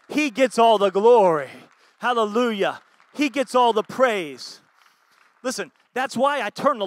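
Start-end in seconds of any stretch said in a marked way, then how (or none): 0.1-4.6 loud, slow, ringing preacher's call; preaching at full force. Real, not acted. Pastor proclaiming to the church, loud and slow. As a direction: lift the room, slow and loud. Preaching climax, glory to God.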